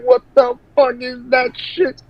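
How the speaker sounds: high, startled mock outrage; retold outburst. Waking up to a Confederate pillow, high shocked outburst. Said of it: "I'm like".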